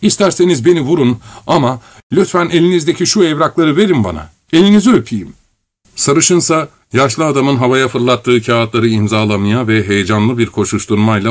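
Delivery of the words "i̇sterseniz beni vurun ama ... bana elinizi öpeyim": pleading. A narrator's reading. Korotkov begging desperately.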